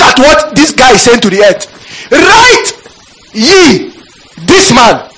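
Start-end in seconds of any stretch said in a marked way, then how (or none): 2.1-5.1 proclaiming. Acted. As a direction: declare the verdict with force. Preacher proclaims the verse.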